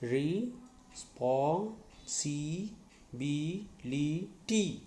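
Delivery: very slow, each syllable drawn out. Shown as a demonstration. Teacher to students, spelling out syllables, very slow. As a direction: stretch responsibility out slowly so students hear every syllable. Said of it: "You see".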